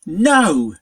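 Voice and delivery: loud harsh no; pony refusing the spirit, loud and harsh; rasped no. A narrator's reading. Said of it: "she rasped".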